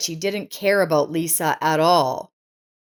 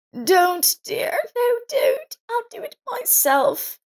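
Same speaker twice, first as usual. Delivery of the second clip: put-on high, small pleading voice of an old woman close to tears; tearful old woman; slower than usual. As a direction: refuse help gently while holding back tears. Old woman refusing, about to weep.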